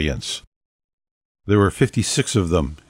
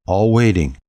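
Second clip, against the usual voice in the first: ordinary delivery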